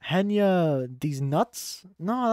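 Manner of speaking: ordinary delivery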